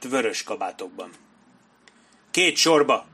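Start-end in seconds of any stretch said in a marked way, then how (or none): 2.3-3.1 higher, louder battle command; officer yelling over battle; yelled order. A narrator's reading. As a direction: shout them into formation. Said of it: "üvöltötte Sharp".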